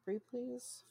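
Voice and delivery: small, high, soft plea; soft begging. Pretend. Podcaster echoes co-host begging for Firefly, soft pleading. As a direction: beg sweetly in a little high voice. Begging streamers to revive show.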